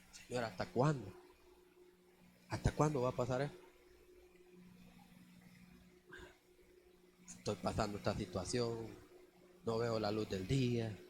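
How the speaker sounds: soft, weary complaint to God, voice dropped low; a tired, lightly voiced lament in the car; complaining prayer. Preacher retelling his weary complaint to the Lord, softly. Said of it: "me iba quejando".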